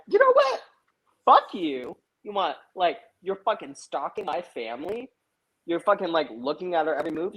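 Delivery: high-pitched mock outrage, retelling mom's tirade; imitated angry mom. Mom confronts the neighbor stalking her family, imitated indignation. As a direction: act out mom losing patience, pitch jumping up.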